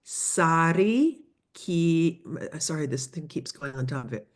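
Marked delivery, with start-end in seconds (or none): 0.0-2.2 "Sari Ki-" drawn out slowly, syllable by syllable; slow teacher's model of "Sari Ki-", cut short; careful pronunciation. Shown as a demonstration. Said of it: "How to pronounce the town of".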